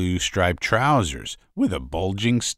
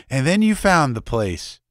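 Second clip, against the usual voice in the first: breathless; 7 semitones higher, 4 dB louder than usual. Acted. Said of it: "said breathlessly".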